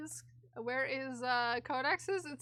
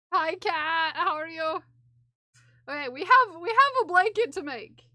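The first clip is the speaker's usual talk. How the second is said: loud hype-train yelling; 4 semitones higher, 9 dB louder than usual. Real, not acted. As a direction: shout over the hype train. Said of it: "I can stop yelling now".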